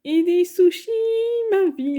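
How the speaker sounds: high, loud burst of joy; delighted. Real, not acted. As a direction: squeal over the sushi. Spots her favourite food. (s'extasier sur les sushis, plus fort)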